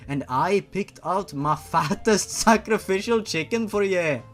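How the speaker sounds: a lightly put-on Scottish-Irish lilt for the old witch; old shop witch in a Scottish-Irish brogue; hint of an Irish brogue; Scottish-Irish accent. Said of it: "I sound more like fucking Scottish or Irish".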